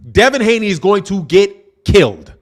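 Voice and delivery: ordinary talk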